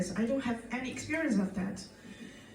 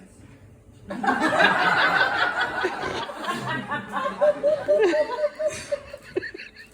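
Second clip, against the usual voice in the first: loud high howl of laughter; a long, loud fit of laughter; long loud laughing fit; 13 semitones higher, 6 dB louder than usual. Real, not acted. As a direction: lose it laughing.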